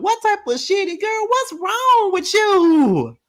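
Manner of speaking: high-pitched appalled wail; shocked squeal. Acted. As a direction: squeal it out in outrage. Outraged at Kay's confessional.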